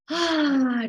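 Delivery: long, releasing exhale on "ah"; audible sigh. Shown as a demonstration. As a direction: let out a big relaxing sigh. Demonstrates a releasing breath out.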